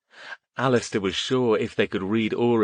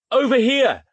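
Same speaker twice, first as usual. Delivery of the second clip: very high, loud call; yell; 7 dB louder than usual. A narrator's reading. Boy yells to the man behind the flashlight, loud call. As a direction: shout toward the flashlight. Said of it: "he yelled".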